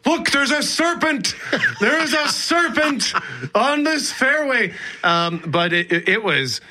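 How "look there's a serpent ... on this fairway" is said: high-pitched, hammed-up alarm at a sighting; excited outcry. Retelling spotting a garter snake at the golf tournament, excited outcry. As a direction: imitate your own excited cry, pitched way up. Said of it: "I got excited. I said".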